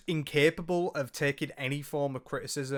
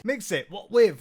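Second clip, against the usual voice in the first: higher, louder mocking echo; mocking repeat; 5 semitones higher, 6 dB louder than usual. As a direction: parrot her words back with scorn.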